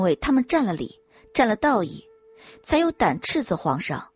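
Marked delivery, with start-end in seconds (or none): none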